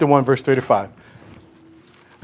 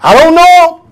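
higher, louder furious shout; loud outburst; cursed denial; 11 semitones higher, 8 dB louder, slower than usual. Acted. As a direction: snap it as an angry denial. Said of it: "start cursing".

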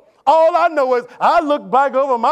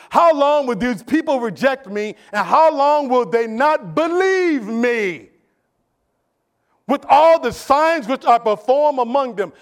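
slow weighty reading; 2 semitones higher, 4 dB louder, slower than usual. Retold or imitated. Reading God's words.

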